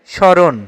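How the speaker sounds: স্মরণ said as "sho-ron"; model pronunciation. Shown as a demonstration. Teacher answers the quiz on স্মরণ, modelling "sho-ron" without m. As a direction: leave the m silent in স্মরণ. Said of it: "স্মরণ শব্দের সঠিক উচ্চারণ কোনটি?".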